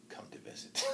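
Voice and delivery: whispered knowing tease; suggestive whisper. Acted. Old woman whispering a saucy warning to the knight. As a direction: drop to a whisper on the hint.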